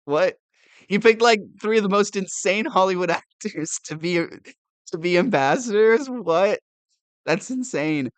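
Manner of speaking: high, loud disbelief with a laugh creeping in; loud, astonished exclaiming; real incredulity. Real, not acted. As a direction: react as if you can't believe it. Stunned by the ambassador picks.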